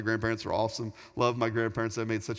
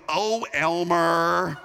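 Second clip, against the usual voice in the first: slow, drawn-out mock scold from an old grandma; grandma's fond scolding; 5 semitones higher, 6 dB louder, much slower than usual. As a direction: stretch it out like a grandma swatting away her flirty husband. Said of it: "my grandma always go".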